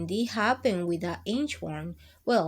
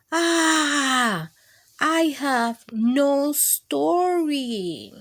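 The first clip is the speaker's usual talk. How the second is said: louder, very slow despairing sigh of a line; frustrated groan; 4 semitones higher, 5 dB louder, much slower than usual. Acted. Ralph stuck at his desk, groaning in despair. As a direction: drag it out like a hopeless kid.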